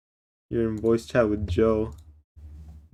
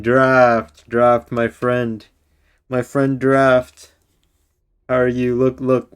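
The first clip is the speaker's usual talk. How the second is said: loud drawn-out greeting; 8 dB louder, much slower than usual. Real, not acted. Hamming greeting to friend Draft.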